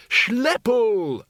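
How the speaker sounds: high shout, voice falling away; Windle's high-pitched holler; shouted call. A narrator's reading. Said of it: "shouted Windle".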